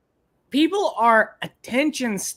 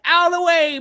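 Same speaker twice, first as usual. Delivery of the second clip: mock cyclist yell; 10 semitones higher, 4 dB louder than usual. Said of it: "They're like".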